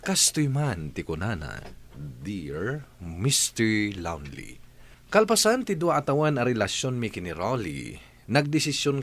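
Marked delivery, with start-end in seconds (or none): none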